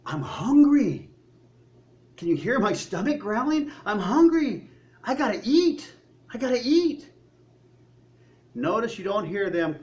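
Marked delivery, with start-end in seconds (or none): 0.1-7.1 higher, louder, whining about an empty stomach; the grumbling Israelites, hungry and demanding; whiny hungry complaint. Retold or imitated. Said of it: "their concern was for their temporal need".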